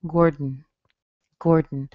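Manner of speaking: "Gordon" released through the nose, no vowel; nasal release. Shown as a demonstration. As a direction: glide from D into N in the nose. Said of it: "the sound in my mouth to the sound in my nose".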